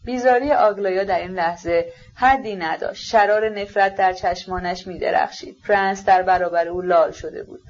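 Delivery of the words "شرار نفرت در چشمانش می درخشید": ordinary delivery, nothing marked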